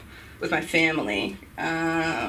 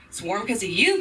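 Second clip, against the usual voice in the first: usual speaking voice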